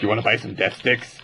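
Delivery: shady dealer voice. Retold or imitated. Star Wars dealer quote.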